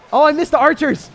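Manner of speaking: high, loud yelp of dismay; real alarmed outburst; dismayed shout. Real, not acted. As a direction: cry out at your own blunder. Dismay at own misplay.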